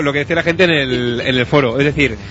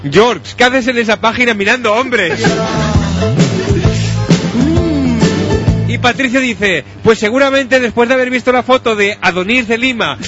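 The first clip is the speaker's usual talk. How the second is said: higher, declaimed read-aloud voice; forum post read-out; 5 semitones higher than usual. Acted. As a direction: read each post out like a soap recap. Reads forum posts aloud. (lectura declamada de mensajes del foro)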